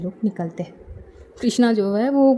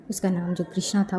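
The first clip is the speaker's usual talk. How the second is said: plain reading voice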